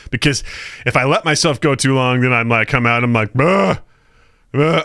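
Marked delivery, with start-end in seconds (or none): 3.3-4.8 groggy grunt. Retold or imitated. Groggy waking after long sleep.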